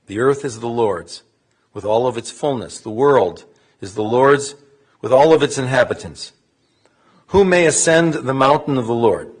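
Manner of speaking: solemn, measured recitation; psalm reading. Real, not acted. Clergy reads the translated psalm to mourners, solemn recitation. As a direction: read it formally, pausing after every verse.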